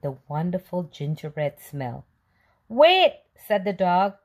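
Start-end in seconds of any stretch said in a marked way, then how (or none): 2.7-3.2 high, loud dog's call. Acted. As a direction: stop the cookie with a sudden shout. Dog calling the cookie.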